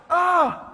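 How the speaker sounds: short high yelp, loud; startled yelp. Retold or imitated. Startled by the bird, a loud high yelp. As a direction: yelp in surprise. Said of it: "I'm like".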